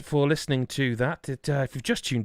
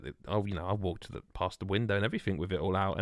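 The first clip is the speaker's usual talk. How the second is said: usual speaking voice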